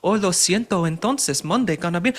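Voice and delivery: high-pitched, hammed-up fake Mexican accent; mock a put-on Mexican accent; mock Kamala Harris Mexican accent.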